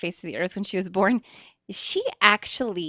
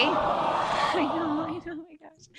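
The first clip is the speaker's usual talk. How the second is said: emotional laugh; 7 semitones higher, slower than usual. Real, not acted.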